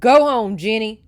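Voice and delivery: loud command hurled over a storm; shouting. A narrator's reading. Man on horseback sends girl away, shouting over rain. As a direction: shout it hard, falling at the end. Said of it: "he shouted above the pelting rain".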